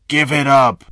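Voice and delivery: loud, menacing demand at gunpoint; threatening. A narrator's reading. Robber to victim at gunpoint, loud menacing demand. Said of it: "he threatened".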